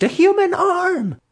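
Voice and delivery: shriek. A narrator's reading. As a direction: scream it out in terror. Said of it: "she shrieked".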